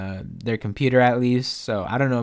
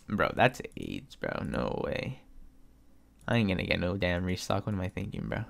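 defeated mutter; 4 semitones lower, 8 dB quieter than usual. Real, not acted. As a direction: sink into it, all hope gone. Gives up on the restock.

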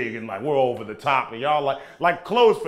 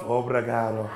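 low, amorous croon; mock-romantic, lowered voice; in love; 5 semitones lower, 4 dB quieter than usual. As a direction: drop low and say it lovingly. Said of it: "If I'm in love".

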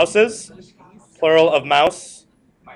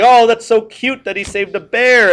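higher and louder gushing over something cute; children gushing about the saved bear; cooing children; 7 semitones higher, 5 dB louder than usual. Retold or imitated. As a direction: melt like a kid hearing a sweet story. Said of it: "many children heard the story, like".

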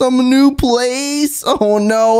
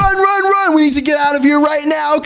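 frantic shouting while fleeing; being chased; 3 semitones higher than usual. Acted. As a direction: yell at everyone to run. Fleeing the tiger.

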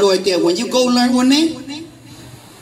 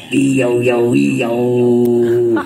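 low, drawn-out "yo" call; love call; 11 semitones lower, much slower than usual. Shown as a demonstration. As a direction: call out low like a night cricket. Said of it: "secret love language nobody know".

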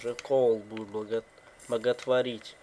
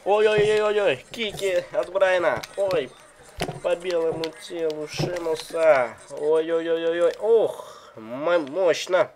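excited commentary; 4 semitones higher, 7 dB louder than usual. Real, not acted. Excited play-by-play at big moves.

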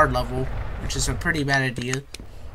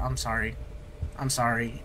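quiet; 3 semitones lower, 9 dB quieter than usual. Real, not acted. Apologizing after shutting Chica out.

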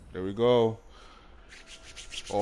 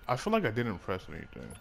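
ordinary talk, nothing marked

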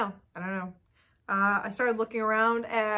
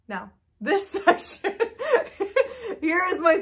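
flustered laughing; 7 semitones higher, 4 dB louder than usual. Real, not acted. Fumbling while showing her knitting.

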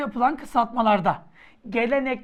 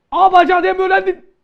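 fast angry shout; 7 semitones higher, 11 dB louder, faster than usual. Acted. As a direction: rattle it off fast in a loud, high yell. Teacher repeats the scolding.

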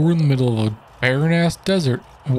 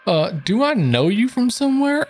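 starstruck fan voice; 9 semitones higher than usual. Acted. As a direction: gush like a fan. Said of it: "nice voice acting stuff".

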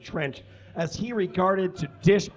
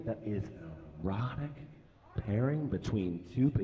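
sultry; 7 semitones lower, 10 dB quieter than usual. Acted. Teasing an erotic story.

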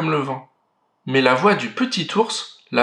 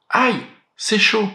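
put-on higher, slow little girl's ouch; a child stung by hot soup; a girl burning her tongue on hot soup, higher and slow; 8 semitones higher than usual.

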